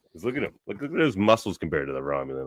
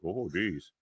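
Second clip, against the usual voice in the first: softer, deflated oh geez; hurt little mumble; 8 dB quieter than usual. Retold or imitated. Shaxx after Lily snaps, a soft deflated oh geez. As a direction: shrink back like a scolded giant. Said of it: "He's like".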